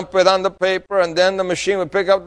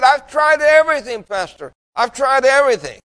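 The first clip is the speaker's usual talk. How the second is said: high, plaintive, exasperated; lightly voiced old woman giving up hope; pleading old lady; 7 semitones higher than usual. Elderly smoker pleading with her pastor, high and plaintive.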